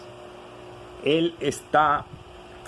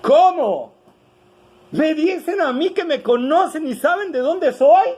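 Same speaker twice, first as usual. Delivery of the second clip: higher and louder, mocking disbelief; loud ironic question; 9 semitones higher, 5 dB louder than usual. Shown as a demonstration. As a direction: call it out so the whole crowd hears, ironic. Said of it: "el texto dice en alta voz. O sea, no lo dijo bajito". (pregunta irónica en voz alta y aguda)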